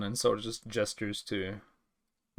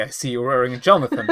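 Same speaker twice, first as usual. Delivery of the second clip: mock awkward remark; 10 dB louder, faster than usual. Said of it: "So he can't say".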